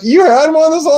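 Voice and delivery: imitating the daughter, shocked; hammed-up astonished squeal; mock disbelief.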